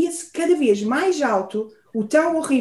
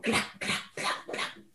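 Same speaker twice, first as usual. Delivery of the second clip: whispered cracking; 14 dB quieter, slower than usual. A narrator's reading. Moose knocking the tree.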